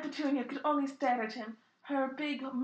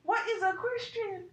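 higher, wide-eyed innocent question; innocent child; 8 semitones higher than usual. Acted. Harry asking Hagrid about faith, innocent child voice. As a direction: ask like a curious little boy. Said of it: "Harry queried innocently".

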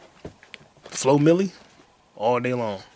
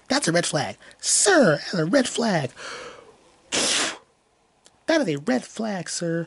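high, mocking voice with raised pitch; Lauren Compton; 7 semitones higher than usual. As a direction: parody her warning, high and exaggerated. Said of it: "Lauren Compton".

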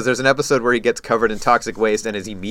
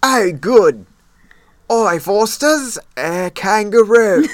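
higher, slow, hammed-up Australian accent; mock Australian accent; 9 semitones higher, 3 dB louder, much slower than usual. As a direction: play a cartoon Aussie, badly. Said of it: "great Australian accents".

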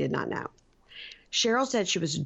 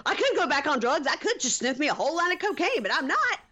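much higher, strained imitation of a raging wife; hammed-up tantrum, way up high; mock hysterical rant; 11 semitones higher than usual. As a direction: screech it like she's losing it by the car. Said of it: "Cheryl goes nuts by the car".

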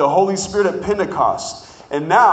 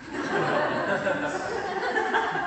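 laugh; 7 dB quieter than usual. Real, not acted. Laughing at own forgetfulness.

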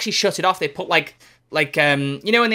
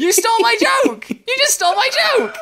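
much higher, louder, slow hammed-up indignant squeal; play-shriek that your joke was stolen; mock outrage; 13 semitones higher, 3 dB louder, much slower than usual. Co-host accusing friend of stealing his joke, hammed-up high shriek.

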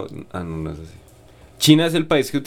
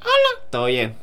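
voice of Sebas. Pretend. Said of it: "fingamos la voz de Sebas".

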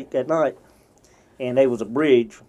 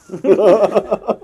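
big high laugh breaking out; cracking up at the punchline; real laugh, loud and high; loud burst of laughter; 8 semitones higher, 8 dB louder than usual. Real, not acted. Laughing at own story.

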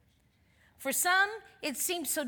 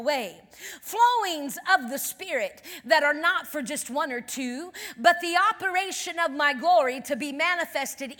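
loud, lifted, measured proclamation; =emphatic charismatic declaration; prophetic preaching; 5 semitones higher, 10 dB louder than usual. Real, not acted. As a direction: proclaim it over the congregation with force. Prophesies to congregation.

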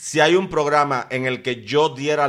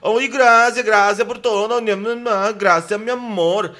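hammed-up Brazilian accent, high and louder; mock Brazilian gushing thanks, pitched up; acento brasileño; 7 semitones higher, 6 dB louder than usual. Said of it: "con su acento de brasilera". (exageradamente con acento brasileño, agudo y fuerte)

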